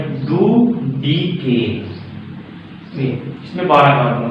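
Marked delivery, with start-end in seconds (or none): 0.0-1.9 slow singsong repeat. Shown as a demonstration. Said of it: "लिख लिया हम लोगों ने".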